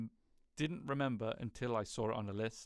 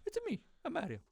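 very high mock Italian accent; Mario; faster than usual. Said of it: "accent is, uh, questionable".

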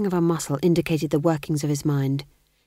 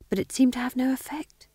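plain reading voice

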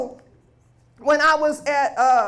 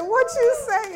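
higher and louder, a startled cry; stunned disbelief; 9 semitones higher, 5 dB louder than usual. Retold or imitated. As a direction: ask it as if you can't believe your ears. Mother hears son was saved.

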